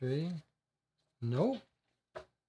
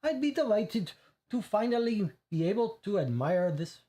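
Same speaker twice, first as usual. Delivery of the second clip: high, put-on Russian lady voice; mock Russian accent; 7 semitones higher than usual. Player voicing the disdainful Russian woman, mock Russian accent. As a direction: try on a haughty Russian accent. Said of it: "I'm not doing the Russian accent".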